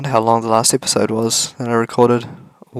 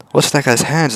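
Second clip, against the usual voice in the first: normal talking voice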